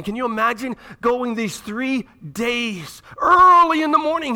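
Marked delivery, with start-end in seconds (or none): 3.2-4.3 sudden loud declaration. Acted. Dramatic storytelling peak.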